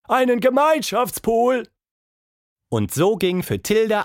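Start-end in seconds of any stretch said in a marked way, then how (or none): 0.1-1.7 very high, loud call; shouted answer. A narrator's reading. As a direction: holler the answer up to the window. (laut hinaufgerufen)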